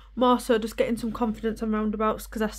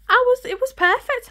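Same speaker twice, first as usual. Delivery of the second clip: excited; 14 semitones higher, 7 dB louder than usual. Retold or imitated. Retelling excited success.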